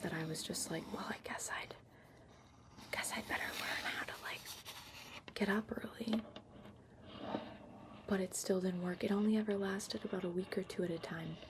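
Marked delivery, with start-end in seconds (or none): none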